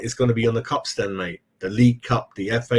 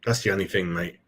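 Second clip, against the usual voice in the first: usual speaking voice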